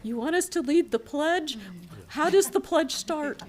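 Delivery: high, loud mock schoolgirl panic; laughing, high imitation of nervous girls; girls' quote. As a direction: squeak it like flustered young girls. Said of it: "they were like".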